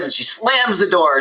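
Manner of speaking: usual speaking voice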